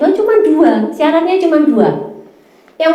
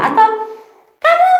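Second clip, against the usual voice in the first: squeaky high voice. Real, not acted. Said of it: "maafkan suaraku".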